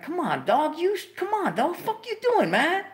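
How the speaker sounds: very high, exasperated whine; whiny protest. Retold or imitated. Son begs Steve to stop shaming him, high-pitched whine. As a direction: plead with him to knock it off.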